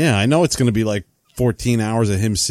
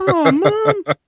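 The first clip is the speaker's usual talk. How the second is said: put-on whiny teen; 4 dB louder than usual. Whiny reply as Bill.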